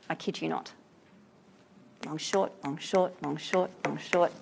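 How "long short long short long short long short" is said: softer, beating out long and short; iambic rhythm demo. Shown as a demonstration. Teacher showing students the poem's meter, soft rhythmic beats. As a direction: tap the meter out like breathing. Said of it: "captured in the iambic pentameter".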